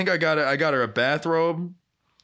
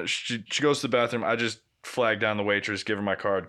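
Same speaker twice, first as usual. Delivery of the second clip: ordinary delivery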